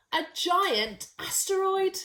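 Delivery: ordinary delivery, nothing marked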